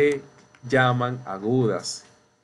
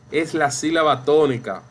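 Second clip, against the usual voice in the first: usual speaking voice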